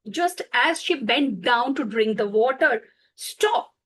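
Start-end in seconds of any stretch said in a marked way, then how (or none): 3.2-3.7 sharp high cry of Stop; the fox cries out to halt her; fox calls stop. Acted. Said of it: "the fox voice echoed".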